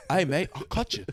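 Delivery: rough Cockney-style British threat, a mild mock menace; lightly put-on London tough-guy British accent; lightly voiced Cockney British accent threatening a friend; trashy British accent. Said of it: "that's the trashy British accent".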